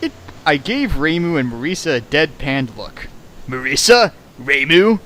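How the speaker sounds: usual speaking voice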